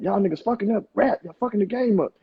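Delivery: lightly imitated complaint, pitched well up; high-pitched mock griping. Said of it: "[name] was like".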